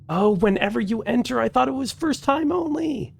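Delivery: exasperated disbelief. Real, not acted. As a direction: whine it up high like you can't believe it. Discovers doors relock, exasperated.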